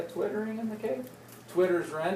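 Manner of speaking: usual speaking voice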